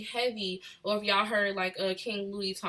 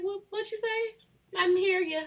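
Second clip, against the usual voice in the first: high-pitched mock confusion; acted-out baffled listener, high voice; confused questioning; 10 semitones higher, 3 dB louder than usual. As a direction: pitch it way up, as if you missed the words. Acts confused listener out west.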